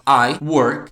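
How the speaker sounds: I work said loud and firm; teacher giving the right form loudly; correct form. Shown as a demonstration. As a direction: land I work cleanly as the right answer. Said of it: "eu teria que falar assim".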